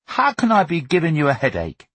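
put-on high, wounded boyish question; hurt. A narrator's reading. Son hurt by mother's accusation, wounded. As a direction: ask it as if unjustly accused. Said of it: "he asked, hurt by this further accusation".

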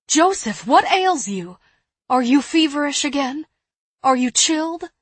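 high, loud, anxious questions; alarmed. Acted. Worried sister questioning sick brother.

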